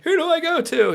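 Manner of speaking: comic flustered wail; frantic indecision. Retold or imitated. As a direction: cry it out, torn between two. Acting out being frantically torn.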